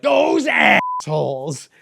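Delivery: slow, loud mock-outraged shout; mock outrage. Mocking the brand's outrage, a slow loud shout. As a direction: bellow it like a cartoon of offended outrage.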